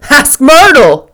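loud, high, slow call; hearty mocking shout; shouted with laughter. A narrator's reading. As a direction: boom it out, about to laugh. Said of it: "breaking into a short shout of laughter".

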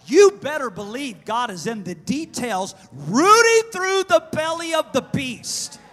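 loud, high, driving preacher delivery; full-force preaching. Real, not acted. Preacher warning the congregation God will act, full-force preaching. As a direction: preach it with booming urgency, stretching key phrases.

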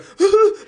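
sudden high burst of laughter. Real, not acted. Remembering his mates showing up, a high loud laugh. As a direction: squeal a laugh.

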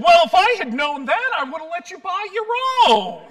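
loud, high, rushed mock outrage; retold angry yell, speeding up to the last word; indignant outburst. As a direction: imitate an offended lady exploding mid-thanks. Retold lady angrily storms off.

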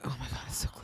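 hushed, voiceless marvelling; awed whisper. Acted. Friend imagining walking round the cleaned home, awed whisper. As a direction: whisper it in reverent awe.

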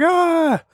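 a short high mock scream of terror. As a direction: yelp a quick, hammed-up "Ah!" way up high. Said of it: "He just screams for his life".

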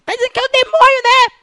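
high mock mother voice; imitating his mother. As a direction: play the worried mom. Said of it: "minha mãe ouve assim". (imitando a mãe, voz aguda)

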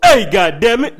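loud, sudden angry outburst. Retold or imitated. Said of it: "[name] real voice".

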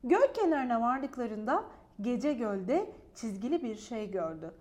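ordinary delivery, nothing marked

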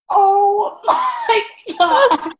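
high, loud, very drawn-out mock wail; hammed-up mortified shout. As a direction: stretch it into a laughing cry of embarrassment. Retold mortified reaction, hammed up.

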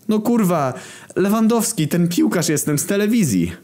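high, indignant cartoon voice; scruffy footballer protesting to the park drunks, high. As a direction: insist on who you are.

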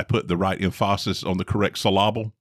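"emphasis" as em-FAH-sis, "syllable" as suh-LAH-bull; wrong-syllable stress. Shown as a demonstration. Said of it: "putting the emphasis on the wrong syllable".

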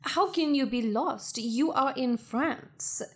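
put-on French accent. French Beth tells lost visitor he is in France, French accent. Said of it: "looks like she is a native in France".

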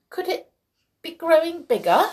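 high, alarmed wondering; puzzled and frightened. A narrator's reading. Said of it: "puzzled and frightened".

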